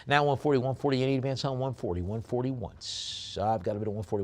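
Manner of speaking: fast, high, loud auctioneer chant; rapid-fire auction call; auction bid calling. Acted. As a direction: rattle off the bids like a live auctioneer.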